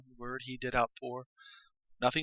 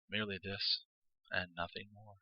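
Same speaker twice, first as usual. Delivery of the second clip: ordinary delivery, nothing marked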